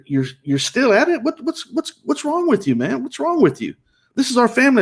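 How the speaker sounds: very high-pitched retold reproach; acted-out distraught challenge; exasperated pleading. Retold pleading confrontation with wife.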